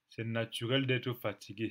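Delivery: normal talking voice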